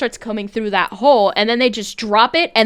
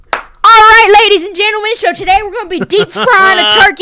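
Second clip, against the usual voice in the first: loud, high hammed-up cooking-show announcer; mock Southern host; 9 semitones higher, 11 dB louder than usual. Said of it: "this is what I hear every single time".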